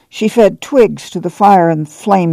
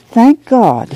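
relieved cry; 6 semitones higher than usual. A narrator's reading. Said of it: "Ma cried".

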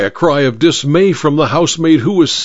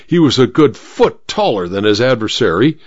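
ordinary delivery

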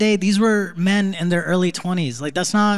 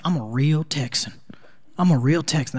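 mock Texan classmate; 3 semitones lower, 3 dB quieter than usual. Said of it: "Everyone's like".

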